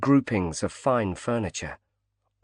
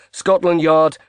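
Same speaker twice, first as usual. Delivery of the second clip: urgent; 6 semitones higher, 6 dB louder than usual. Acted. Knight interrupted mid-plea.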